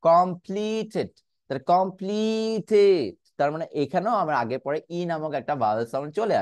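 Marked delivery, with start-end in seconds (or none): 0.1-3.1 completed drawn out slowly; slow careful demo. Shown as a demonstration. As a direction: spell out completed slowly for the class. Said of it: "খেয়াল করে দেখুন". (ধীরে ধীরে completed)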